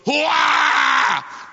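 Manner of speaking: high, loud mock baby howl; imitating a baby's sudden howl; baby's wail. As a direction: belt it out like a bawling infant. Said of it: "He goes".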